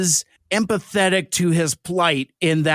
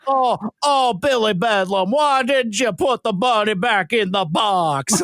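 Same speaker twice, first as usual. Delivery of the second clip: mock Southern accent; 3 semitones higher than usual. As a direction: parody a Con Air Southern accent. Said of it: "that Southern dialect".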